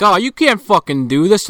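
loud, high-pitched imitation of a protester; mock outraged protest. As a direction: cry out in exaggerated indignation. Retold Bob protesting executions.

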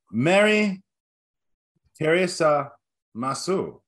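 a clumsy mock Japanese accent, drawn out word by word; bad Japanese accent. Host quizzing co-hosts in a terrible Japanese accent. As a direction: fake Japanese greeting with long pauses between words. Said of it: "worst Japanese accent you have ever attempted".

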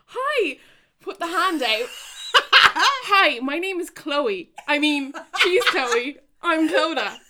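high, loud, hammed-up eager fan hello; over-eager greeting. Reenacting awkward fan handshake.

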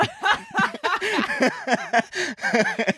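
fast, through laughter; words broken by laughing. Real, not acted. Laughing at a bad joke.

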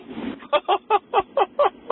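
high cackling laugh; maniacal laugh. Real, not acted. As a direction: let a wild laugh break out. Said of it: "a maniacal".

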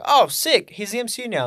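high, loud burst of delight; acted-out fan excitement; excited reaction. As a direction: re-enact being thrilled by the news. Acts out own excited reaction.

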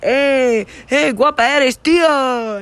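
catcalling yell. Retold or imitated. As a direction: yell it across the street at a girl. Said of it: "yell from across the street".

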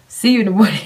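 a mock brush-off. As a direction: toss it off loudly. Joke brush-off.

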